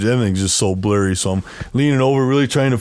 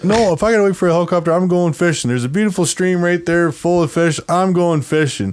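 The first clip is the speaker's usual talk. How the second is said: higher-pitched, insistent comeback; retold defiant arguing; 7 semitones higher than usual. Patient refuses order to wait in truck, retold defiance. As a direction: argue back stubbornly, pitched up. Argues back at yelling woman.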